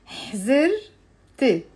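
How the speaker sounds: slow, careful حزرت, ending with e; slow drill. Shown as a demonstration. Teacher has students repeat حزرت slowly. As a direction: draw out حزرت for repetition. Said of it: "saying out loud with me".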